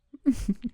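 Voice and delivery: a short flirty laugh that falls away. Acted. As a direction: chuckle at the listener's reaction. Flirting, teasing the listener.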